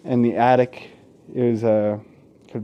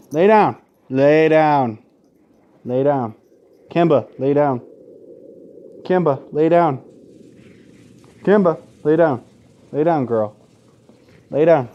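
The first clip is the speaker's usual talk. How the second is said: firm, raised commands to a dog; repeating a firm command to a restless dog; 2 semitones higher, 4 dB louder than usual. Real, not acted. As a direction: tell the dog to lie down and mean it. Ordering dog to lie down.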